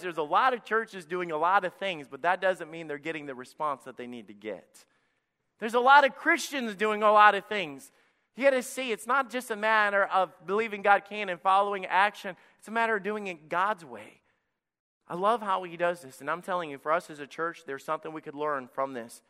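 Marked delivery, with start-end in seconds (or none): 5.6-14.2 loud, high and driving, pushing the point home; preaching at full force. Acted. Pastor urging his church off the pew, loud preaching. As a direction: preach it hard to the congregation.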